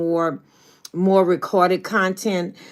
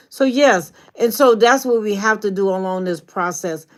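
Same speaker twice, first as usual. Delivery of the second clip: ordinary talk, nothing marked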